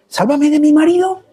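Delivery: high-pitched questioning plea; mock pleading. Preacher acting out churchgoers' everyday prayers, mock pleading. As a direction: offer each petition as a pleading question. Voicing people's petitions to be saved.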